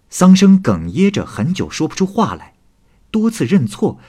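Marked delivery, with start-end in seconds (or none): none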